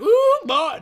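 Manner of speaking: put-on high, loud twangy "Ooh, boy"; country twang. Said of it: "I'd just be like".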